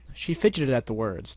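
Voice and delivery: plain reading voice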